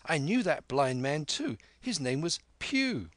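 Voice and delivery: put-on high-pitched young informant; lightly voiced keen lad; eager boy. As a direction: offer it up high, landing on the name. Boy tells Silver.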